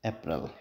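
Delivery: अप्रैल said softly as "eprel"; a teacher models the right way to say it; correct pronunciation. Shown as a demonstration. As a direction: say अप्रैल as "eh-prel", stressed on the first syllable. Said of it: "उसका सही pronunciation करने के लिए".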